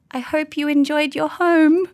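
high, slow and awkward; sweet high voice; awkward sing-song. Retold or imitated. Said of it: "and I just said".

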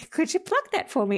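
high, amused Scottish brogue quoting a posh man; old Scottish woman mimicking "Could you pluck that for me?". Old woman recalls Orwell's request, in a Scottish brogue. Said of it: "says in this rather wonderful Scottish brogue".

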